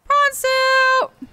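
high, loud delighted cry; excited shout. Real, not acted. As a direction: announce it with glee. Excited at crafting item.

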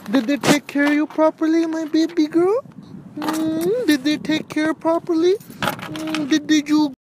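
high-pitched doting coo; baby talk. Acted. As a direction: fuss over your beloved baby girl. Cooing to recovered car as baby.

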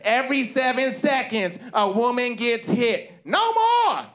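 high, impassioned proclamation rising to a shout; rally the crowd, peaking on the last words; hammed-up activist cry. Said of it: "And she yells out stats".

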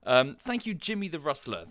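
ordinary talk, nothing marked

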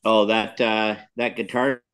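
usual speaking voice

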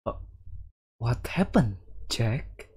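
hushed, worried whisper; soft whispered concern to Jack. Acted. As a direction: breathe the question to him. Worried woman asks quietly.

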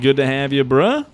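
loud, high welcome; excited greeting. Real, not acted. Streamer welcoming a caller, loud and high. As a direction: shout it out happily.

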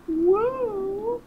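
very high, sustained woo; mock-dramatic high whoop; playful "woo". Mock fanfare after announcing dilemma.

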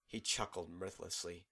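usual speaking voice

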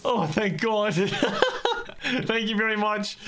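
high, giggly thanks; laughing relief. Real, not acted. Spared after begging for life.

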